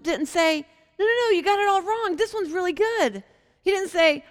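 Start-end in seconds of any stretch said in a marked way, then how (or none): 1.0-3.2 eager protest. Pretend.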